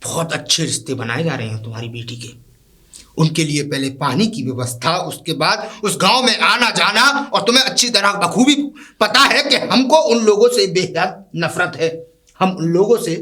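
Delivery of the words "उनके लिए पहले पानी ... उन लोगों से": building to a loud, high furious rant; father raging to his wife about the poor, loud and high; rising rage. Acted. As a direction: let the hatred boil up louder with each phrase. Father rages about daughter and the poor.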